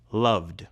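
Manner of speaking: "Loved" ending in a voiced d. Shown as a demonstration. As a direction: say "Loved" as one syllable with a final d. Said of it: "in loved".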